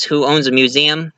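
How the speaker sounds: ordinary delivery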